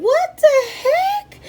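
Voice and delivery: very high, slow, drawn-out what the heck; shocked. Retold or imitated. Telling how siblings' insult floored her, squeaky stunned what the heck. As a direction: stretch it out in stunned disbelief.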